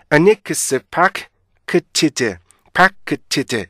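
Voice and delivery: "unexpected" as anikse, pak-ki-ti-de; stop-sound syllables. Shown as a demonstration. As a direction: beat out "파크치즈" syllable by syllable. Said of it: "이렇게요". ("파크치즈"를 음절대로 또박또박)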